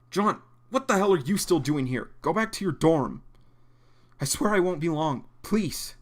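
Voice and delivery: loud, high exasperated scold. Acted. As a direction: scold him to go back. Voicing exasperated doc.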